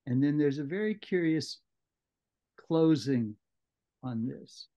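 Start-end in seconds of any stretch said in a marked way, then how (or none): none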